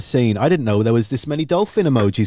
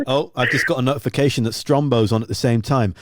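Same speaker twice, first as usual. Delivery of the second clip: normal talking voice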